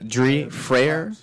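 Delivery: "Dree Freyer" said slowly, word by word; slow down and spell out "Dree Freyer" by ear; careful name repeat. Shown as a demonstration. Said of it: "Say the name for me one more time".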